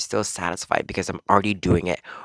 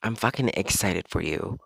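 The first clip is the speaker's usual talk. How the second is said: normal talking voice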